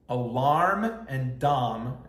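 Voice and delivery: "alarm" and "dom" said slowly in an American accent, with an R in "alarm", so they clearly don't rhyme; slow American reading of "alarm" and "dom". Shown as a demonstration. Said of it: "If you say this with an American accent".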